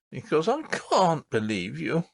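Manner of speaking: ordinary delivery, nothing marked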